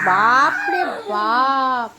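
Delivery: long drawn-out playful exclamation; mock amazement. Real, not acted. Mother marvels at baby's loud voice, drawn-out mock amazement. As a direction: stretch it out slowly, hugely impressed.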